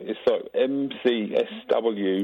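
a put-on Cockney accent. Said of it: "you're talking in a Cockney accent".

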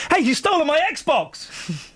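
high, slow, indignant cry; host playing victim of stolen Xbox, outraged high cry; mock outrage.

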